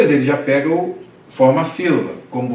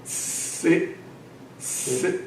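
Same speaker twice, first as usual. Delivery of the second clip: whispered syllables; 4 semitones higher, 9 dB quieter than usual. Shown as a demonstration. Teacher drilling syllables.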